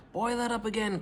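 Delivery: high excited call to George; eager cry. A narrator's reading. Father urging George on, high eager cry. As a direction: cry out keen to try again. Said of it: "cried Mister Cranky".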